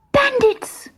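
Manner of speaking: very high shocked cry; a high horrified child's cry. Acted. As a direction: cry it out in horror. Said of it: "cried Martha in horror".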